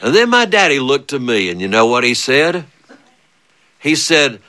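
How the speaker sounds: ordinary talk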